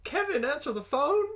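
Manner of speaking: high, loud quoted film line; mock yell. Co-host joining in mocking the film's delivery, calling out. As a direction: call it out high in imitation.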